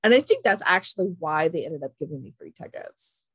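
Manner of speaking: usual speaking voice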